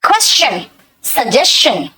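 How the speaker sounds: क्वेश्चन and सजेशन said with ch after the s; pronunciation demo. Shown as a demonstration. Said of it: "ये शन का pronunciation".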